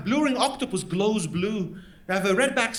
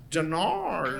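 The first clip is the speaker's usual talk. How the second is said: lower, softer lazy Aussie "Dunno"; Australian accent; 5 semitones lower, 4 dB quieter than usual. Retold or imitated. Answering what are you doing tonight, Australian accent. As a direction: mumble "Dunno" like a laid-back Aussie. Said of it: "You go".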